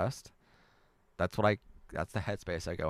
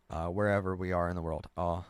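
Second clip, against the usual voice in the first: ordinary talk, nothing marked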